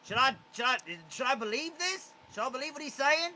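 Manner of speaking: a confused charmed goblin begging for orders; the goblin nervously asks Quetzal what to do. Acted. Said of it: "he looks to Quetzal".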